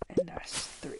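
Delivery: whispered three; whispered count. Real, not acted. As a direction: whisper the last count to yourself. Counting spots quietly.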